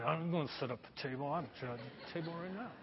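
soft, fast grumble under the breath; grumbling under breath. Retold or imitated. Husband heading to the garage grumbles at his wife's request, soft and fast. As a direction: mumble it, fast and annoyed.

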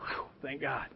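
relieved aside. Retold or imitated. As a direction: let the air out, barely voiced relief. Retold relief after recognition.

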